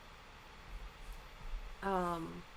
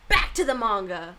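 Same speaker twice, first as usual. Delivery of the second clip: loud announcement; 12 dB louder than usual. Real, not acted. Announcing return to reading.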